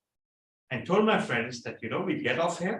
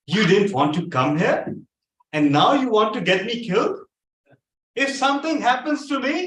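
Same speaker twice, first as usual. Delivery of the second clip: louder, rising, fast angry outburst; angry accusation; 6 semitones higher, 5 dB louder than usual. Retold or imitated. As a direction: accuse them hotly, voice climbing. Accusing friends of a plot.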